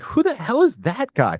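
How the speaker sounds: high, astonished who the hell; amazed disbelief. Retold or imitated. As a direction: sound blown away by a stranger. Retold amazement at Ron Paul.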